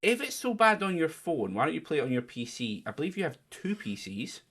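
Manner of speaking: ordinary talk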